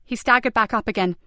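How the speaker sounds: ordinary delivery, nothing marked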